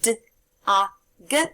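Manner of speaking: "D, A, G" as three separate sounds; sounding out words. Shown as a demonstration. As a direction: segment "D, A, G" sound by sound with pauses. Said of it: "One more time".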